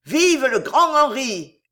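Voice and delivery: loud slow crowd cry; shouted cheer. A narrator's reading. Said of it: "hurlèrent les ligueurs".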